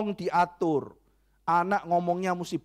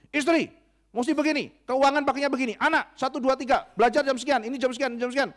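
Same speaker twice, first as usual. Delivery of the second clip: bossy husband; 7 semitones higher than usual. Acted. A controlling husband ordering his wife and kids, bossy. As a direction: lay down the rules to the family.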